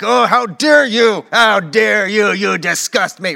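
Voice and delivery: loud, drawn-out mock outrage; finger-wagging scolding. Christians wagging fingers at the culture, mock indignant scolding. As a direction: scold like a hammed-up moralizer. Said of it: "they'll just start wagging fingers".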